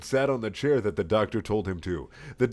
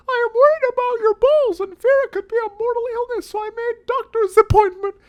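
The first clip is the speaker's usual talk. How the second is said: much higher, louder nagging mom voice; hammed-up mom; 7 dB louder than usual. Mom confronting Sonic about his journal, high fussing voice.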